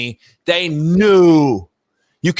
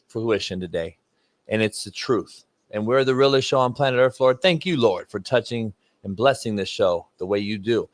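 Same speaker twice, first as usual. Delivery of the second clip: low, solemn mock prayer to the Lord; hushed preacher praying with a straight face; 7 semitones lower, 4 dB quieter than usual. Host praying to the Lord in mock earnest, solemn prayer voice.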